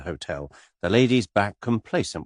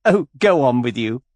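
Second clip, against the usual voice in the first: higher, louder flirty cry; coquettish cry; 5 semitones higher, 7 dB louder than usual. A narrator's reading. Miss Taylor flirting with Mister Cade, coquettish. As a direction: toss it out coquettishly. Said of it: "cried Miss Taylor coquettishly".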